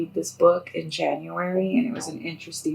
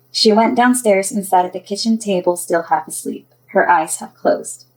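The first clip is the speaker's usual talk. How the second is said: louder, projected storytelling voice; story narration; 3 semitones higher, 9 dB louder than usual. A narrator's reading. Author tells of two girls waking, story narration. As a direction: read it out clearly as a storyteller.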